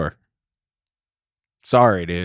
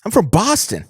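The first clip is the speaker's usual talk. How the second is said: higher, hammed-up Boston boast; mock Wahlberg; 5 semitones higher than usual. He plays the star introducing himself on the plane. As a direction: brag like a movie star.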